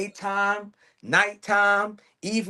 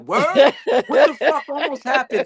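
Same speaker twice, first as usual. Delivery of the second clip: higher, louder, laughing through the mock shock; mock alarmed concern; 10 semitones higher, 6 dB louder than usual. Friend reacting to her knee story with laughing mock alarm. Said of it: "And you'll be like".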